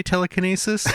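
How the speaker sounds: "telekinesis" with a NAY in kinesis; Canadian pronunciation. Shown as a demonstration. Joking that Canadians say it so, "telekinesis" with NAY. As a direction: say "telekinesis" as tele-kuh-NAY-sis. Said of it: "that's how Canadians say".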